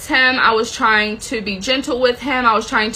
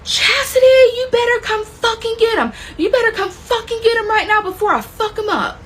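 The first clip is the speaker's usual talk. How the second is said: furious yell; 11 semitones higher than usual. Retold or imitated. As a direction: scream it like you're ready to hurt someone. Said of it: "I hear this man yelling at the top of his lungs".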